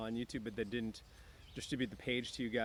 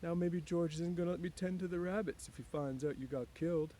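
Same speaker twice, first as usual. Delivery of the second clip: put-on higher childlike voice, fretting; a simple man afraid of getting in trouble; lightly voiced Lenny, worried; 8 semitones higher than usual. A narrator's reading. Lenny talks to dead puppy.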